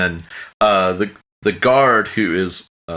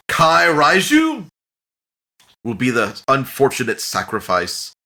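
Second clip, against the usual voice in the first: grave announcement; 4 semitones higher, 3 dB louder than usual. Acted. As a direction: announce the sacrifice to the crowd. Speaker announces the chosen sacrifice.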